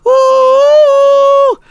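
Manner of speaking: high, hammed-up anguished cries; mock wailing grief. Gamer wailing at his dead colonist Mama, high hammed-up cries. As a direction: wail over a dead pawn like a tragedy. Mourning Mama's death in game.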